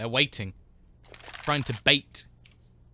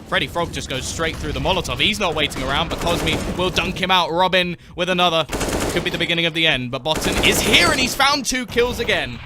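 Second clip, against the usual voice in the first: louder, higher rising commentary; excited play-by-play; 5 semitones higher, 8 dB louder than usual. Acted. Caster calling the comeback win, excited and loud. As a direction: call the round's finish, building hype. Hype casting of a fight.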